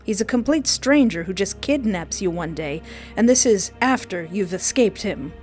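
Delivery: ordinary talk, nothing marked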